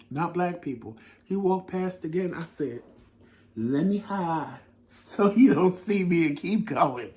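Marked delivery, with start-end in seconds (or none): 3.6-7.2 laughing through the words, hiding from him; giggling. Retold or imitated. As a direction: giggle it like a guilty kid. Giggling while recounting hiding from him.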